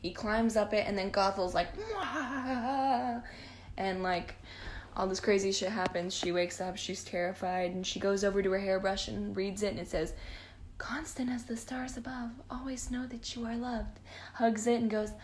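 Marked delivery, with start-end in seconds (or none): 1.8-3.2 short mock villain cackle; a quick hammed-up witch laugh; evil cackle. Gothel mocks the nightmare with a mock villain cackle. 10.8-14.0 softer, a bit higher, tender inscription read. Retold or imitated. Rapunzel reads her hairbrush inscription, soft and tender.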